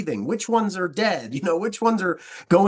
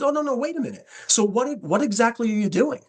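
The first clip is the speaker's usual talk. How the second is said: retold surprise, pitched much higher; sudden interest; 7 semitones higher than usual. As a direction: catch herself and call him back, higher and eager. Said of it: "And she goes".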